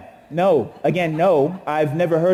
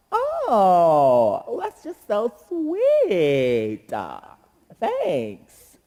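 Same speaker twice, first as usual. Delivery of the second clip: put-on slow, sugary, dismissive girl voice; Anne's reply; much slower than usual. The crush brushing off the flowers, in a slow sugary voice. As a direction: fake sweetness then brush him off. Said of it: "Anne's response".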